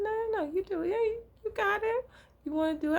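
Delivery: high, pushy no-no-no, handing it back; high and insistent, waving her off; insistent deferring. Retold or imitated. To the coworker, insisting she take over, high pitched. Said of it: "And I said".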